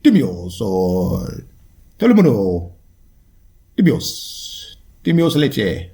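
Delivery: slow, hammed-up Telemundo announcer Spanish; mock Telemundo Spanish. Clowning in mock Spanish to his listeners. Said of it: "It's gonna sound like some Telemundo shit".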